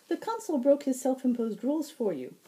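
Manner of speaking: high, ringing, lilting fae voice; bright high herald. Acted. Fae herald greeting the wounded Zack, high and bright. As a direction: sing it out high and sweet.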